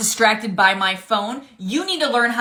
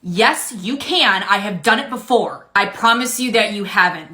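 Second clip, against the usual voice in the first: usual speaking voice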